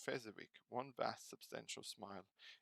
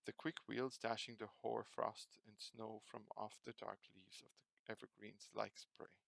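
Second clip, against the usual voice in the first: usual speaking voice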